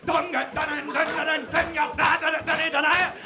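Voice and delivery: high, shouted rhythmic chant; loud, excited chanting; fully into it. Retold or imitated. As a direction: belt the words out like a rave hook. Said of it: "I start getting into it".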